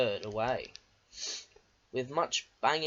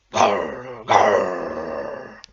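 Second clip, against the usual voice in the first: low, loud engine growl; roaring growl; 8 semitones lower, 10 dB louder than usual. A narrator's reading. Diesel losing patience with the trucks, loud low roar. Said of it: "he roared".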